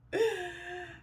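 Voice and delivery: high, soft burst of laughter; real laugh. Real, not acted. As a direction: laugh at her own journal. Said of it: "I'm laughing".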